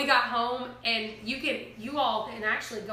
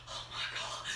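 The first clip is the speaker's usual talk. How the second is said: whispered gasp; 10 dB quieter than usual. Retold or imitated. Panicking on mountain road.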